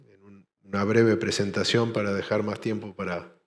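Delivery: ordinary talk, nothing marked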